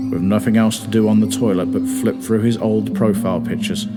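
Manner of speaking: ordinary delivery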